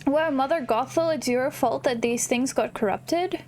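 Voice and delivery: ordinary talk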